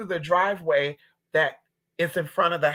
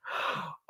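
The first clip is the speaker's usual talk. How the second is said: sharp gasp; 12 dB quieter than usual. Retold or imitated. Fear at darkness.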